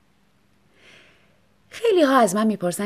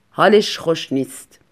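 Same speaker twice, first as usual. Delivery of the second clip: husband aside; 3 semitones lower, 5 dB louder than usual. Acted. Husband apologizes to salesman.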